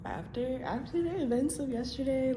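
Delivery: high, loud, dramatic buildup; breathless gossipy storytime. Real, not acted. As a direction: announce it like big gossip. Dramatic restart of intro.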